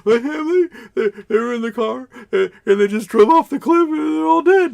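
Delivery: put-on very high, loud wailing little boy; mock bawling. Little boy tells priest of family's crash, mock bawling. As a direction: sob it out as a hammed-up distraught child. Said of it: "who's just bawling his eyes out".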